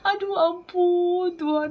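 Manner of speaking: high, drawn-out cry for mercy; mock wail to God; retold tearful plea. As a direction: plead upward, stretching the words as if weeping. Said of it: "saya nangis".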